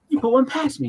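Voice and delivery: high, amazed mock exclaim; imitating amazed Larry. Larry realizing Steve snuck the cameo in, high amazed voice. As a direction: play Larry caught out and delighted. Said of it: "And he's like".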